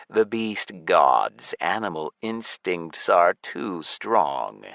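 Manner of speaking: low, slow undead voice; lich voice. Acted. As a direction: speak like a cold ancient lich. Lich speaks slowly.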